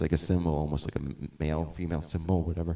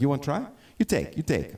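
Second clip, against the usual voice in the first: higher, light imitation of a Spanish accent; 10 semitones higher than usual. Luthier offering the guitar, light Spanish accent imitation. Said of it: "But he had an accent".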